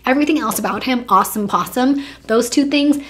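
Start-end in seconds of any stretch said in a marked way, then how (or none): none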